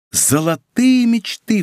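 high, drawn-out cry of rapture; elated, slow and soaring; rapturous exclamation. A narrator's reading. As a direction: exclaim it like a dream come true. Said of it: "вскричал Арамис".

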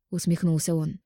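ordinary delivery, nothing marked

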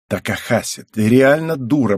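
understated hiss of irritation; hissed rebuke. A narrator's reading. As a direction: scold her, drawing out the words. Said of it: "прошипела Цугару".

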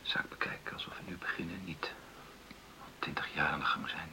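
soft, low and gentle; tender hushed comfort; soft reassurance. Acted. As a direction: coax her calmly, almost whispering.

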